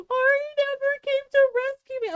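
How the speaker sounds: high, quick mock-crying complaint; tearful wail. Little sister complaining to mom about Ari, wailing. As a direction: imitate a wailing child. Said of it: "starts crying more".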